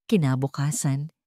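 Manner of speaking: plain reading voice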